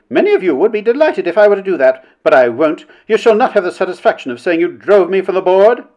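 haughty defiance in a lifted voice; defiant. A narrator's reading. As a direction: stand up to the tormentors. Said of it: "looking round defiantly at her tormentors".

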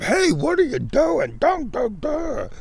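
mimicking a noisy stranger who scares the animals, high and mocking; mocking an intruder. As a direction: lampoon the clueless visitor high and hammed-up. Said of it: "some idiot comes up".

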